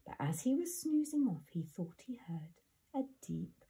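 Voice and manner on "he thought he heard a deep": whispering. A narrator's reading. Narrator whispers spooky opening.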